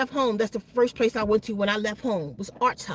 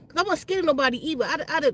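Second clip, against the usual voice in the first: ordinary talk, nothing marked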